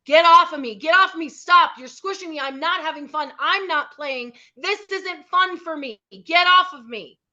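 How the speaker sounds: high, loud and firm, shouting it out; loud shouted boundary, pitch up and forceful. Shown as a demonstration. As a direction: yell it so everyone nearby hears. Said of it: "You're gonna say it loud, like, loud".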